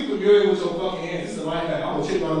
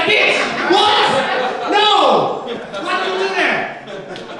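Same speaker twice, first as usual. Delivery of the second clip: high, loud shocked shout; high-pitched outrage, drawn out at the end; outraged disbelief; 11 semitones higher, 3 dB louder, much slower than usual. Retold or imitated. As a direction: yell it in horrified disbelief. Outraged at friend giving name.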